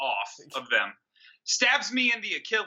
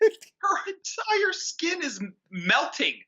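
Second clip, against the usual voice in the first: mock outrage; 11 semitones higher, slower than usual. Mocking the film's absurdity.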